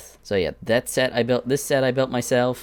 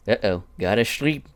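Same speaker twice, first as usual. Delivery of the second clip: lightly put-on character voice; the restrained take on a character impression; Vito impression. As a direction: voice a character at a moderate volume. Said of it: "How's my Vito's impression?".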